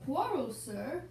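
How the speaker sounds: usual speaking voice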